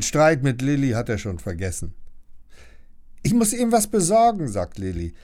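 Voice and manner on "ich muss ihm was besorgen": much higher and louder, rushed call; urgent errand; hurried exclaim. Acted. Lilly calls out in a rush, high and loud.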